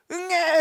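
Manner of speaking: high, loud mock baby wail; sudden loud "waah" like a baby; imitated newborn cry. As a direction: imitate a newborn's first cry at full volume. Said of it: "하고 웁니다".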